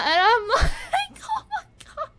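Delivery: high, laughing squeals of delight; fangirling squeal. Real, not acted. Fan reacting to the singer's delivery with high squeals. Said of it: "feel the fangirling just coming in".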